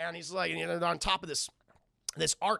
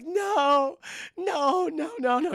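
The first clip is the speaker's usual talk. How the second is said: high, loud hammed-up wailing; mock crying; 11 semitones higher, 7 dB louder, much slower than usual. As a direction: cry out in imitated grief. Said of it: "She's all crying".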